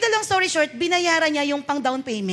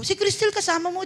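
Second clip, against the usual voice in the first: ordinary delivery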